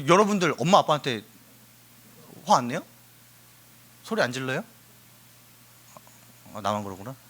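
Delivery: ordinary talk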